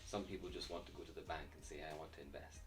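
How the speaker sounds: ordinary talk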